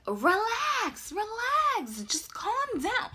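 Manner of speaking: high, drawn-out mock soothing; imitated friend urging calm. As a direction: stretch it out high as the exasperated friend. Voices friend soothing her.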